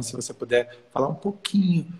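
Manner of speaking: ordinary talk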